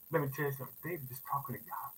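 usual speaking voice

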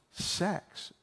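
whispered like a delicate secret; mock whisper. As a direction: mouth it in a hushed imitation of the wife. Wife names the delicate topic.

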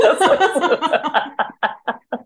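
real peal of laughter; hearty laugh. Real, not acted. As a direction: laugh out loud at a joke. Laughs at co-host's joke.

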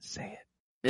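hushed imitation of a whispered dare; short whispered challenge; retold whisper. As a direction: whisper it under your breath. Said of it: "whispered it to him".

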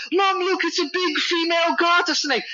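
put-on high, eager child's voice; a young son holding up a snake to his mom, high and thrilled; excited little boy. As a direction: play a small boy showing off his catch. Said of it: "he's like".

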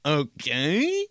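OKAY drawn out with a rising end; doubtful okay. Shown as a demonstration. Showing how ellipses sound to others, OKAY slow and rising. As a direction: stretch Okay upward into a wary question. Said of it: "it's like you're saying it like".

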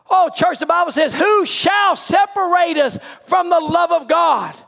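high, fervent sermon cry; impassioned pulpit proclamation; full-force preaching. Real, not acted.

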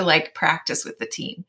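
usual speaking voice

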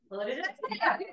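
exasperated quote through laughter; laughing. Retold or imitated. Mom mimicking her own exasperation, laughing. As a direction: laugh it out in disbelief. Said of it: "my teeth are like".

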